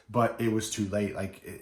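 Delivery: ordinary talk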